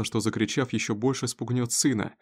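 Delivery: ordinary delivery, nothing marked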